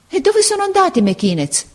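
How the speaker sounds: put-on fearful boy asking; heart pounding. A narrator's reading. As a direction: ask with a racing heart. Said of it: "domandò Marco col batticuore".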